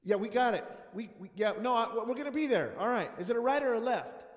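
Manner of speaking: loud, booming phone voice; mock loud pastor.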